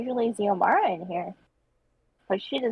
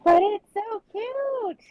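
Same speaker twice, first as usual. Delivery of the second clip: gushing; 12 semitones higher, much slower than usual. Real, not acted. Gushing over drawing.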